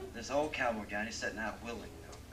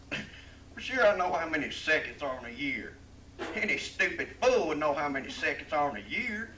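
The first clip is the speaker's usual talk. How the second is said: scoffing cowboy; 6 semitones higher, 10 dB louder than usual. Acted. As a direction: brush it off as obvious.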